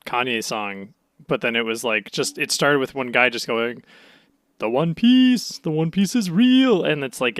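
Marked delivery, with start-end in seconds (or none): 4.6-6.8 slow, odd put-on voice; bad voice. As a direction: say it in a deliberately bad voice. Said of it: "in a bad voice".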